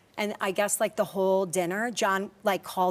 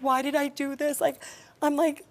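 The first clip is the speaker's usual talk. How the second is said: higher, fast, tearful rush of words; real tears breaking through; 6 semitones higher than usual. Real, not acted. Retelling her husband's painful dinner, tearfully upset. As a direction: voice cracking as she fights back crying. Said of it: "I am crying".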